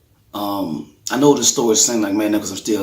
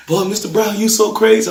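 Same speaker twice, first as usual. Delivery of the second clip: high, laughing voice, louder at the end; laughing nurse; 13 semitones higher, 7 dB louder than usual. Retold or imitated. Nurse laughing at the father's corkscrew joke. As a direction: mimic the nurse cracking up. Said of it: "And it was like".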